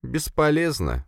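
high, slow resigned sigh. A narrator's reading. Sofia answers her boss, sighing resignation. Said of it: "вздохнула Софья".

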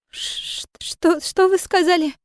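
high, slow stammer of shock; stammering in surprise. Acted. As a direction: stutter out the question, stunned. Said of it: "От удивления Сон Ми начала заикаться".

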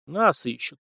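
plain reading voice

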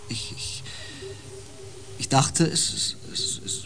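breathy stammer trailing to a whisper; halting whisper. Acted. Osden hesitantly recalls the forest's feeling, half whispered. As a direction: grope for words in a whisper.

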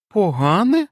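high, suspicious questioning echo. A narrator's reading. Wife doubting the doctor's advice, suspicious rising echo. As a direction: repeat the word with doubt, rising. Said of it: "підозріло запитала Енн".

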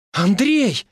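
high-pitched cry of the name; desperate call. A narrator's reading. Wife rushes to her departing husband, desperate. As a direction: call after him urgently, pitch way up.

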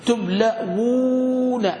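"تبلون" stretched very slowly, long held "wuu"; two waws sounded out. Shown as a demonstration. Teacher shows class the easier two-waw form, stretched slowly. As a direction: draw out "تبلون" as tub-laa-wuuu-na. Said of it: "في الواوين أسهل في النطق".

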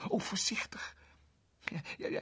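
breathless whispered worry; anxious whisper. A narrator's reading. Girl fretting in a whisper as her cat is handled. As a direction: whisper it so as not to startle the cat.

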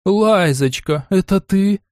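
high, drawn-out surprised call; surprised exclamation. A narrator's reading. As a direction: call out in disbelief. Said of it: "воскликнула". (удивлённо воскликнула)

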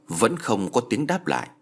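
ordinary delivery, nothing marked

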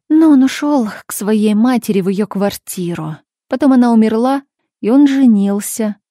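ordinary delivery

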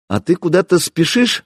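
wry. A narrator's reading. Hero teasing his worried comrade, wry. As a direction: tease with a touch of a smirk. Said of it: "усмехнулся я".

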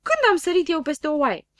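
high, loud protesting question; indignant disbelief. A narrator's reading. Man disputing a rhyme about himself, indignant.

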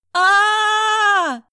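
high, loud piercing scream; woman screaming on seeing the body; strained scream. A narrator's reading. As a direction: scream in horror at full force. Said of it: "Надсадно закричала Прасковья".

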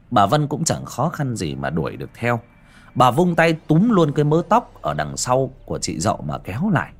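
ordinary delivery

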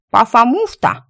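chewing while talking; mouth full. Acted. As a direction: talk through a mouthful of sandwich. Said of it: "чавкая начала белка".

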